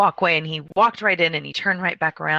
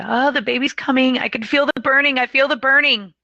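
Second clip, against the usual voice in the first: high, mounting retold cry of alarm; raised, high-pitched imitated outcry; urgent cry; 7 semitones higher, 5 dB louder than usual. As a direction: cry out urgently like a labouring mother. Labouring mother cries out.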